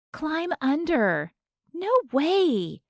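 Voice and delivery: excited fan voices. A narrator's reading. Fans arguing at the stall.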